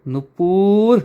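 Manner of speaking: drawn-out, rising angry call of a name. A narrator's reading. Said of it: "तायडी खूप चिडते".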